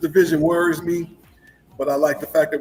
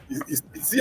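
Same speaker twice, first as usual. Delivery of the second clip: usual speaking voice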